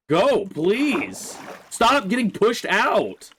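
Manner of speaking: high, loud begging yell, slow at the end; exasperated pleading. Real, not acted. Player begging the horse up the waterfall, exasperated yell. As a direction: beg the dumb animal, losing patience.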